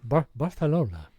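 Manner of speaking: Barcelona pronounced with a Spanish accent; careful try at Spanish pronunciation. Shown as a demonstration. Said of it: "trying to say it with the right accent".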